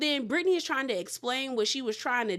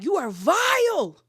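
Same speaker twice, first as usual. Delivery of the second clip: mock disgusted; 7 semitones higher, 6 dB louder, slower than usual. Acting Meredith's insult.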